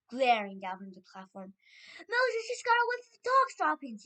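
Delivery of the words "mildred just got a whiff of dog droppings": high, loud called-out report; calling back. A narrator's reading. Witch answering the Grand High Witch, calling out. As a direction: call it up to the leader in a high voice. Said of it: "someone called back to her".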